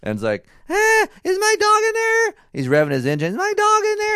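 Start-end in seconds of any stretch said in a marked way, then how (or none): 0.7-2.3 very high, loud mock yell; hammed-up squeaky kid calling out; imitating teenage son. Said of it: "my fourteen-year-old son". 3.3-4.2 very high, loud mock yell; hammed-up squeaky kid calling out; imitating teenage son. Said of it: "my fourteen-year-old son".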